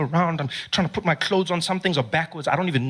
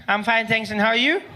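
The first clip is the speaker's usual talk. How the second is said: little brother voice; 5 semitones higher than usual. Retold or imitated.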